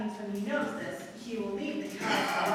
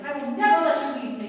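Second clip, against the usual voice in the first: desperate cry; 3 semitones higher, 8 dB louder than usual. Acted. Aisha clinging to her lover.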